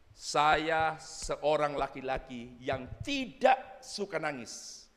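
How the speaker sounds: very slow, deliberate testimony; kesaksian seorang bapak. Retold or imitated. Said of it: "Ada satu bapak bersaksi begini". (bersaksi pelan, kata demi kata)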